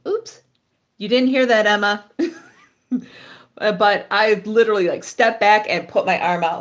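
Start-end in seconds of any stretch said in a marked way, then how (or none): 0.1-0.4 surprised. Real, not acted. Catches herself swearing.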